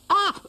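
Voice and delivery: high startled cry; dog jumps when crept up on; startled yelp. Acted. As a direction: jump with a yelp of fright. Dougal startled.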